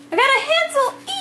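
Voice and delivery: high excited squeal. Real, not acted. Pulling a Hansel card, high excited squeal.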